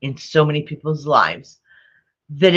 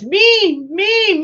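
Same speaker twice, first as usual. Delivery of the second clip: put-on baby voice. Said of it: "He doesn't do any of that".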